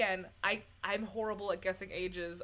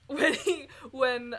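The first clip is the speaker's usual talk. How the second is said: usual speaking voice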